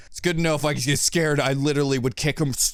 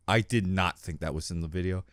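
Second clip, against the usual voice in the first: normal talking voice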